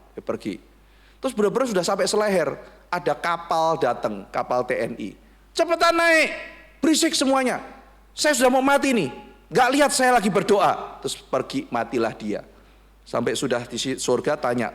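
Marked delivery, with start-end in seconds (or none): 5.6-11.0 very high, louder yells; desperate shouting. Retold or imitated. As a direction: scream at the boat to leave. Man near death yells at navy.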